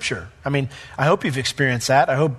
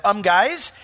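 much higher, chatty know-it-all neighbour; sceptical townsfolk; 7 semitones higher than usual. Retold or imitated. Preacher voicing a doubting neighbour to the crowd, high and chatty. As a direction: play the nosy neighbour setting everyone straight. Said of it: "bring some rational thought".

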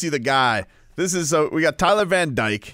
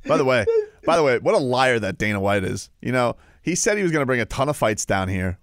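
ordinary delivery

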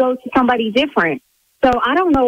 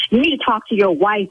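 usual speaking voice

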